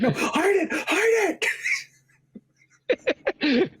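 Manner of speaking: high-pitched frantic yelp; hammed-up alarm; mock panic. Imagined man panicking to hide it.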